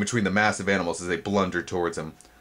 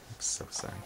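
whispered; 14 dB quieter than usual. Real, not acted. Reader apologizes for mistake.

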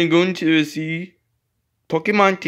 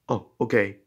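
ordinary delivery

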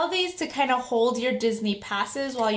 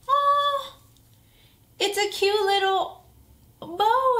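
excited squeal; 9 semitones higher, 3 dB louder, slower than usual. Real, not acted. Surprise at the item.

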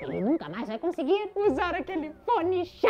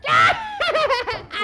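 loud, high mischievous cackle; gleeful cackle; 7 dB louder than usual. Real, not acted. Throwing poop in the pool, loud gleeful cackle. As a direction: cackle like a prankster cat.

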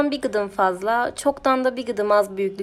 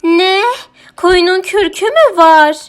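very loud, high, outraged question; roaring; 9 semitones higher, 14 dB louder than usual. A narrator's reading. Mörlala hears the sheep has fur, roaring. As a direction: roar it in disbelief. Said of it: "diye gürledi Mörlala".

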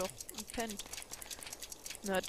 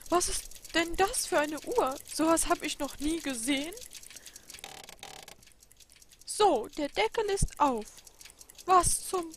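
high and loud, wondering; amazed old clockmaker; 6 semitones higher, 8 dB louder than usual. Acted. Clockmaker opens an odd clock, high amazed voice. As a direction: craftsman stunned by a strange mechanism.

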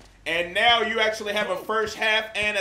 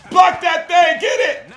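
much higher and louder; urgent shout; 10 semitones higher, 10 dB louder than usual. Real, not acted. Yelling at teammates on defense, urgent shout. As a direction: yell at teammates to block.

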